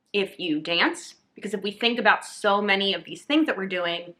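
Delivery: normal talking voice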